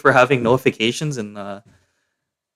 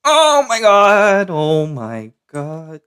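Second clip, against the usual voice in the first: high, slow drawn-out panic; shocked; 7 semitones higher, 4 dB louder, much slower than usual. Real, not acted. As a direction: stretch it out in shock. Nearly killed in game.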